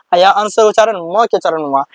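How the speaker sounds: normal talking voice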